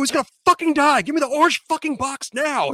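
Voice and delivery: fast, high-pitched frantic order; re-enacted exasperated outburst, voice way up; retold yell. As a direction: yell urgently at someone who isn't getting it. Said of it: "and then I yell at him".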